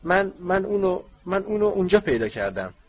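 high, loud halting house-elf voice; trembling elf. Acted. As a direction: stammer it out like a frightened elf.

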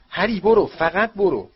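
loud, high urgent order; urgent shout. A narrator's reading. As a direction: yell at Harry to go now. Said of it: "آقای ویزلی فریاد زد".

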